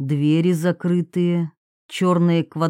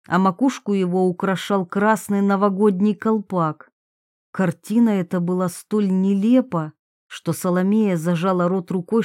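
plain reading voice

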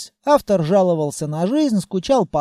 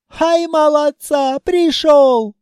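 put-on loud, high Caucasian-accented host; beaming welcome, loud and slow; joyful greeting shout; 6 semitones higher, 4 dB louder than usual. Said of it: "с радостным криком".

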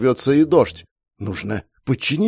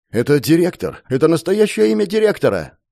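Rupert cries out higher, in a panic; frightened shout; 7 semitones higher than usual. A narrator's reading. Rupert, burned by the abyss, shouts the answer in panic. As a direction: blurt the name out in terror. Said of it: "закричал Руперт".

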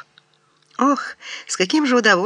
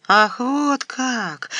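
slow, higher, drawn-out mock cry; outraged yell; 5 semitones higher, much slower than usual. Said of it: "заорала я". (протяжный возмущённый возглас, выше и медленнее)

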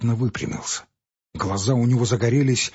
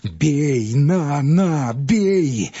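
louder, goading cry of beat me; fierce voice; 4 semitones higher, 4 dB louder, much slower than usual. Acted. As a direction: dare him to strike. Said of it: "подхватил мужик свирепым голосом".

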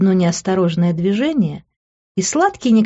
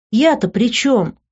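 higher, drawn-out wounded protest; offended innocence; 6 semitones higher, slower than usual. A narrator's reading. Woman denying any blame for his temper, offended innocence. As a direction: play the wronged innocent, slowly. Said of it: "разыгрывала из себя оскорблённую невинность".

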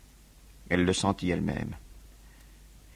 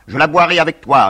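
a much higher, louder cry of loyalty; fervent cry; 9 semitones higher, 13 dB louder than usual. Acted. David answering Robespierre before the Jacobins, fervent high cry. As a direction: throw the vow out to the hall, high and loud.

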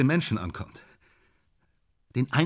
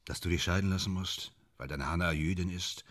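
low, soft, sunken voice; subdued and much lower, with long pauses; deflated admission; 11 semitones lower, 12 dB quieter than usual. Acted. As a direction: let the euphoria drain into quiet bitterness. Euphoria collapses into bleak admission.

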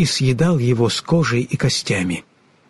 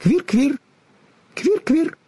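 very high squirrel chatter; squeaky, teasing animal call; mocking squirrel chirp; 12 semitones higher, slower than usual. A narrator's reading. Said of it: "насмешливое чоканье белки".

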